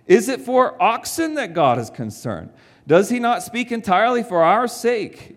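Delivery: scripture reading. Acted. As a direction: read the verses with conviction. Reads scripture aloud.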